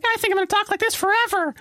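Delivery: very high squeaky cartoon mouse; Mickey Mouse voice. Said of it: "I couldn't stop talking like Mickey".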